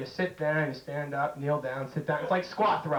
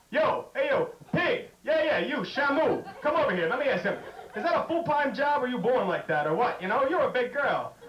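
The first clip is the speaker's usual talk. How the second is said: put-on rowdy guy yelling at a girl; jeering guy; 5 semitones higher than usual. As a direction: heckle her like a rude jock. Mock jeering guy calling out.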